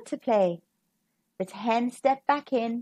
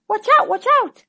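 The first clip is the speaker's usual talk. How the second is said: high, loud warning cry; 9 semitones higher, 6 dB louder, faster than usual. A narrator's reading. A voice warns the hens, high loud warning cry. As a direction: shout the warning. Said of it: "a scary voice cried".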